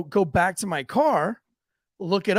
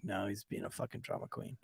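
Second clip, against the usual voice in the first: low, much softer muttered aside; quiet aside; 9 semitones lower, 18 dB quieter than usual. Retold or imitated. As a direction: say it under your breath to a friend. Aside to the EMT friend.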